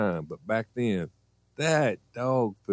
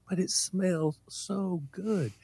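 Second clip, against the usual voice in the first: put-on kid's voice; 8 semitones higher, 5 dB quieter, slower than usual. Kid answers mom dreamily.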